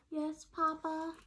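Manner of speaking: high slow timid reply; sheepish little chick. Acted. Chick answering Papa sheepishly, high small voice.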